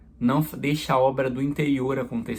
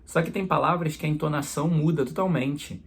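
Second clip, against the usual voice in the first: ordinary talk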